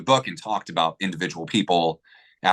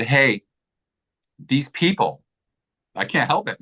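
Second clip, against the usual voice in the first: real choked-up, higher-pitched voice; getting emotional; 5 semitones higher, slower than usual. Real, not acted. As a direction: fight back tears while telling it. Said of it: "gonna get emotional again".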